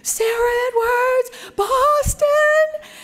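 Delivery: high, loud excited yell across the carpet; screaming call. Retold or imitated. Matt Damon and Ben Affleck spotting her, screaming excitedly. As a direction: shout her name like fans spotting her. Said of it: "they start screaming".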